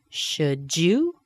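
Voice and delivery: "Should you" linked as "shu-joo", rising; "Should you" with a J, going up; linked J sound. Shown as a demonstration. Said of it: "That D and Y connect to a J".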